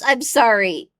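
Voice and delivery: ordinary talk, nothing marked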